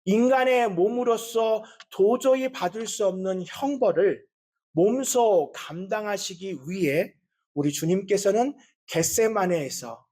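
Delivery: ordinary talk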